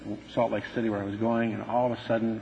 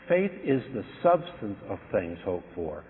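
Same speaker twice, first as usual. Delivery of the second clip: scripture quote; 3 semitones lower, 4 dB quieter than usual. Acted. Quoting scripture slowly.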